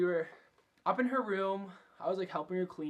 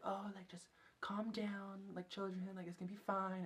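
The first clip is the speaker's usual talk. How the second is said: put-on calm scared children down gently, almost whispering; soothing whisper; 4 semitones higher, 9 dB quieter than usual. Coworker calming kids on phone.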